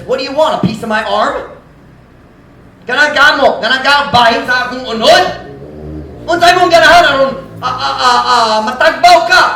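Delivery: high, loud sarcastic challenge; sarcastic retort. Retold or imitated. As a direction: taunt him with mock generosity. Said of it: "my dad turned to him and says".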